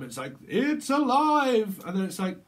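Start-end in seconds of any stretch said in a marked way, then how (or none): 0.5-1.8 loud, high, long drawn-out proclamation; hammed-up mad scientist exclamation; triumphant cry. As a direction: stretch it out at full voice. Film creation cry, quoted.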